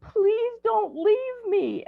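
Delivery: put-on high, slow pleading little-girl voice; imitating a sobbing granddaughter begging; crying child. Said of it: "starts crying and, like".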